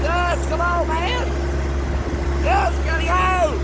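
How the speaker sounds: loud, high shouting to get moving; full-throated high urgent shout; urgent yell. Real, not acted. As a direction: yell in a real rush to escape. Fleeing the storm, yelling.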